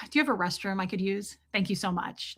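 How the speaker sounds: soft, quick, well-mannered public voice; polite request. Acted. Vlogger asking a stranger for the restroom, polite. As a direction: ask it softly and courteously. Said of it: "Like, please, thank yous, sir, ma'am".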